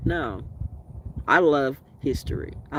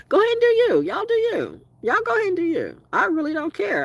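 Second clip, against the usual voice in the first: much higher, sing-song brush-off; mocking dismissal; 4 dB louder than usual. Real, not acted. Brushing off people obsessed with her, high and taunting. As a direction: wave them off in a high taunting voice.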